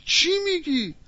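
high-pitched shocked question; storyteller playing a man thunderstruck; acted stunned disbelief. Butcher learning whom he turned away, stunned.

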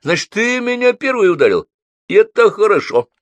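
высоко, громко, с довольной угрозой; будто получил повод для драки; злорадное удовлетворение. A narrator's reading. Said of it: "сказал он с удовлетворением". (с грозным удовлетворением)

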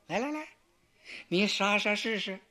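taunting. Acted. Xu You taunts the swordsman.

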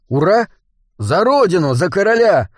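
battle cry. Acted. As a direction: shout it like charging troops. (боевой клич)

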